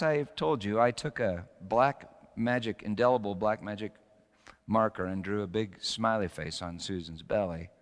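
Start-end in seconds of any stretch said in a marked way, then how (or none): none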